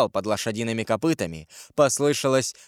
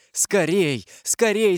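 higher-pitched hurried urging; urgent; 7 semitones higher, slower than usual. A narrator's reading. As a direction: urge someone to escape at once. Urgent warning to flee in story. (встревоженно, торопя)

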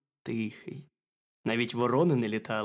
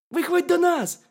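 very high, loud squeaky shout; piercing little critter call; shouting hedgehog; 9 dB louder than usual. A narrator's reading. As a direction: yell it as a tiny hedgehog. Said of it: "крикнув їжачок".

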